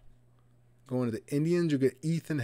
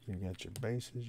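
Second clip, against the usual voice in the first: ordinary talk, nothing marked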